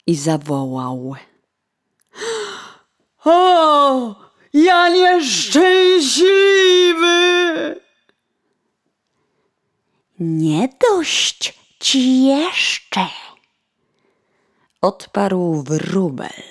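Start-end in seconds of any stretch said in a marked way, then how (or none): 3.2-7.8 high, loud, slow wail on nieszczęśliwy; wailing lament. Acted. Coachman wailing over spilled wine, high and loud. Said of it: "jęknął woźnica". 10.2-13.1 sparrow's taunt. Acted.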